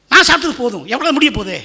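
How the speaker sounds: loud, high preaching cry; impassioned plea. Acted. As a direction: press the plea out at full volume. Preacher voices the prisoner's plea emphatically.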